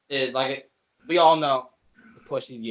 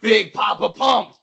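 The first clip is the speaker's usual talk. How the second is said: loud, raised hype shout; big announcer shout; 5 semitones higher, 6 dB louder, slower than usual. Acted. Naming the wrestler Tim Storm must deal with, loud hyped shout. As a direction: belt out the name like a ring introduction.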